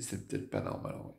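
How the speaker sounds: quiet inner thought. Retold or imitated.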